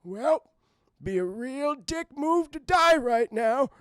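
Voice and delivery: put-on high, slow, strained old man; hammed-up last words, dragged out; dying grandpa. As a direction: croak out a final thought melodramatically. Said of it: "before I pass on".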